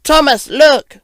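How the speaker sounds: high, loud urgent call; excited storybook shout. Acted. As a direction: call out to show a discovery. Friend calls out to show something.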